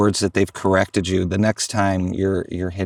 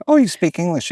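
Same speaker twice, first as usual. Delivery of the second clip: surprised; 9 semitones higher, 4 dB louder than usual. Retold or imitated. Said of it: "And I'm like".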